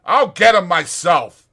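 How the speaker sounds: Slim, angry, ready to step in; angry resolve. Acted. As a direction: jump up to fight. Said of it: "he cried".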